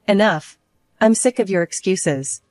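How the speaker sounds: usual speaking voice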